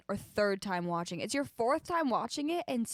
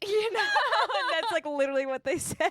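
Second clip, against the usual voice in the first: laughing; 9 semitones higher, 5 dB louder than usual. Real, not acted. Laughing while telling.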